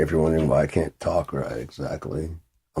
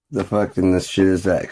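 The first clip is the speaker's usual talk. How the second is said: ordinary talk, nothing marked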